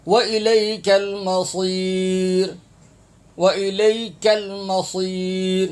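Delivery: slow, drawn-out teaching recitation; Quran recitation. Shown as a demonstration. Teacher modelling a verse for learners, slow Quran recitation. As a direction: hold each long vowel on a steady chanted note.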